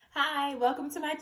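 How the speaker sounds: bright intro take. Shown as a demonstration. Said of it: "I'm gonna do this a thousand times".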